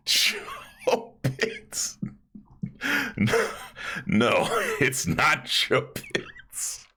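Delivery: high, laughing no; laughing denial. Real, not acted. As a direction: deny it while still laughing. Reacting to chat guess.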